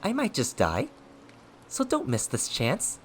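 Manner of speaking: high-pitched mocking singsong; taunting. A narrator's reading. Villain daring his foe not to miss the chance, high taunting. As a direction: dangle the threat teasingly. Villain's defiant goading goes on.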